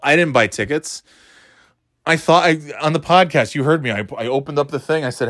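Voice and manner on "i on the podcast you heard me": usual speaking voice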